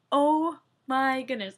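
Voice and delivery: loud, slow drawn-out exclaim; overjoyed. Retold or imitated. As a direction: stretch it out in sheer joy. Hearing husband's score.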